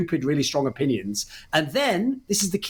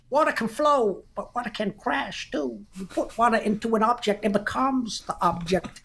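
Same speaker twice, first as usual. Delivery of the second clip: mock Bruce Lee accent; 7 semitones higher than usual. Podcast host mimics a classic interview in a mock Chinese-English accent. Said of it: "I'm doing a sick accent here as well".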